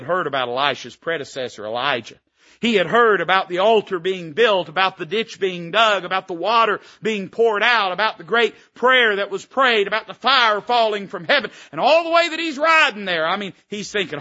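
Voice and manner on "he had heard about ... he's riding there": building from loud to a high ringing shout; preaching climax. Acted.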